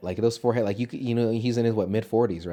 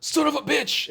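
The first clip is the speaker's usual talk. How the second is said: mock outburst; slower than usual. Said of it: "and he says".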